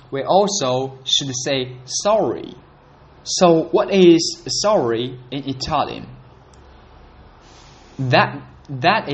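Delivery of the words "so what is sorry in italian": normal talking voice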